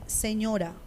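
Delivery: low, soft reply; quiet obedient answer. Retold or imitated. Child replying to mother's gentle call, quiet answer. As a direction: answer mother softly, at once. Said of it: "ya ellos me escuchan y me dicen".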